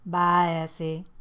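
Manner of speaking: slow, sweet farewell; sweet goodbye. A narrator's reading. Colton saying goodbye to Essie, sweet and slow. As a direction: say it slowly and sweetly. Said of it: "Colton said sweetly".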